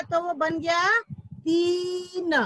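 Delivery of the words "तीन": तीन with a stretched ee, then न; long vowel. Shown as a demonstration. Teacher joining letters into a word, drawn-out तीन. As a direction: hold the ee before the न in तीन. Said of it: "अक्षर जोड़ दिया".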